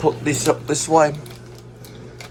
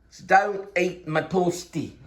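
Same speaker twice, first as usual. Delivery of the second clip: usual speaking voice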